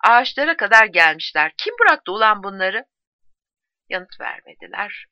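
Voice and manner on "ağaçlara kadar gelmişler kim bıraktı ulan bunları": loud, indignant narrated outburst; angry cry. A narrator's reading. Soldier angry that enemy reached the trees, crying out. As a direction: demand angrily who let them through, raised voice. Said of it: "diye haykırdı".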